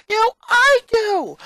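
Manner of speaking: very high, loud, slow scream; furious shout. Acted. Twin screaming at her sister while brawling, very high scream. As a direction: scream it mid-fight.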